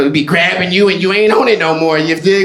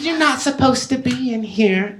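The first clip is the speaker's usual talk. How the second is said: higher, soft Southern belle voice; Southern belle accent; 4 semitones higher, 5 dB quieter than usual. Acted. Girlfriend to her boyfriend in the comic's bit, Southern belle accent. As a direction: gently scold as a Southern belle. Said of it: "And she'll be like".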